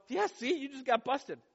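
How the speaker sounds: high, singsong i-told-you-so; gloating taunt. Retold or imitated. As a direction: tease a sibling who just got caught. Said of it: "tempted to go".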